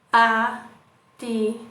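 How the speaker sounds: slow, Atividade split into beats; syllables drawn out. Shown as a demonstration. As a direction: tap out each syllable slowly. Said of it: "quantos sons eu emiti". (Atividade bem escandida devagar)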